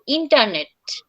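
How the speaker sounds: ইন্টারনেট said the American way with the t dropped, "inarnet"; American internet. Shown as a demonstration. Teacher models the American ইন্টারনেট for the class, dropping the t. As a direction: drop the middle t in ইন্টারনেট, "inarnet". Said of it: "vanishing টি only for American টি".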